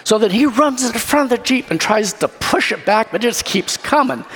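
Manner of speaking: normal talking voice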